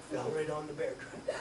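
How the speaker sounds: usual speaking voice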